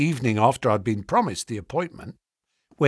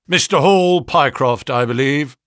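brisk, sharp; 7 dB louder than usual. A narrator's reading. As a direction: address him briskly and sharply. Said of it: "spoke sharply".

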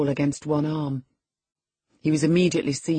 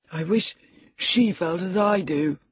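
aggrieved reply; 3 semitones higher than usual. A narrator's reading. Linton replies after his fit.

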